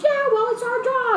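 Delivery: high-pitched mock commentator voice.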